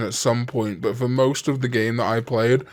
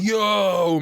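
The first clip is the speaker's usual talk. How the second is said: a higher, quick mock "Yo!"; imitated crowd cheer; 9 semitones higher than usual. Audience cheering as the hammer gets lifted, a mock excited call. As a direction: toss it out like a hyped audience member.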